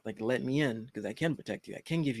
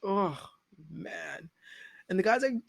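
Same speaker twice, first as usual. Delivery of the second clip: ordinary talk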